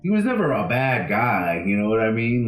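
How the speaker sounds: ordinary delivery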